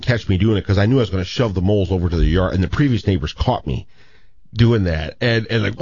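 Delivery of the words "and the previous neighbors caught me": ordinary talk, nothing marked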